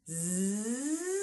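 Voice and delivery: long buzzed Z glide; Z siren. Shown as a demonstration. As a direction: glide the Z buzz smoothly. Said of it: "how long it takes to get back down".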